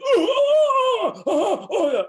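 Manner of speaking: very high loud wordless cries; high shouting grunts; caveman melodic shouts. Shown as a demonstration. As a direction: cry out in pure melody. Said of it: "we talk that way to each other".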